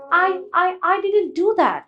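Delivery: hesitant stammer. Shown as a demonstration. Teacher demonstrates a nervous denial, hesitant stammer. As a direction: stumble over the words as if scared to admit it. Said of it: "মানে আমি দ্বিধা করে বলছি".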